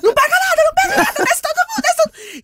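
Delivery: high, loud alarmed yelling; panicked shout. Retold or imitated. Mother rousing her daughters downstairs, high panicked shout. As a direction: yell like the house is on fire. Mom's fake April Fools alarm.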